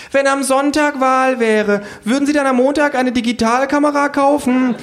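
high, loud survey question; shrill hounding interviewer; relentless pollster. A narrator's reading.

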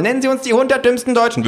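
high, rapid-fire questioning; shrill, breakneck survey voice; badgering pollster. A narrator's reading. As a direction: rattle off the question fast and very high. Mob of pollsters fires questions.

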